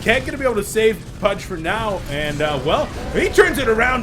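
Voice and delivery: loud, high, excited game commentary; play-by-play. Real, not acted. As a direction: call the turnaround at full volume.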